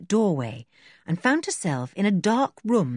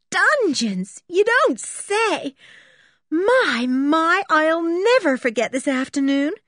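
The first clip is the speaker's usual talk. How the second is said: high, louder, gushing excitement; thrilled amazement; 11 semitones higher, 4 dB louder, slower than usual. A narrator's reading. Berta marvelling at the dungeons, thrilled. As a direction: draw it out, overwhelmed with delight.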